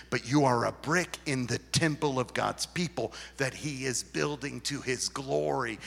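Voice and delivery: ordinary talk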